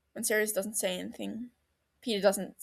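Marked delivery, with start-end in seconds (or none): none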